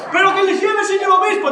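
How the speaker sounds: high shouted plea, hammed-up; nearly crying. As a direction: beg loudly, very high. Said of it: "Te Manolona casi llorando".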